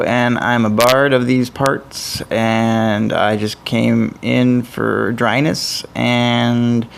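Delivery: slow, folksy Southern drawl as a wandering bard; a hint of a Southern bard, unhurried. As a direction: introduce yourself like a slow Southern minstrel. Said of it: "I don't know why I'm Southern".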